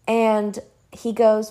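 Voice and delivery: ordinary delivery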